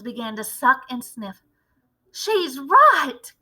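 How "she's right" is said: high excited cry. Acted. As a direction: cry out in agreement. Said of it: "cried another voice".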